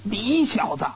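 exasperated general pointing and roaring; enraged yelling. A narrator's reading. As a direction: build from angry to a full yell. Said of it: "气急败坏地指着吴根柱大叫道".

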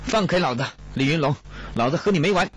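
high, struggling, angry yell; cursing angrily. A narrator's reading. Kong, pinned by soldiers, threatens Li, yelling furiously. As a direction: curse him while being dragged off. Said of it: "一边破口大骂道".

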